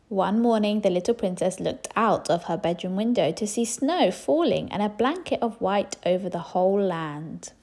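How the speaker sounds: usual speaking voice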